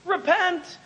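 urgent warning. Acted. Preacher voicing the Bible's warning to the people, urgent. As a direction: plead with the people to turn around. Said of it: "The Bible says".

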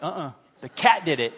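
odd comic dog voice, laughing. Retold or imitated. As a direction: deny it like a guilty dog.

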